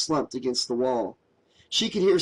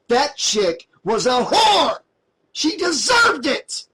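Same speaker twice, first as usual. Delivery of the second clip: slow, much higher, louder furious shout; exploding in rage; 11 semitones higher, 6 dB louder, slower than usual. A narrator's reading. Josh exploding at Mary, slow furious shout. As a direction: explode at her, every word hammered. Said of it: "Josh exploded".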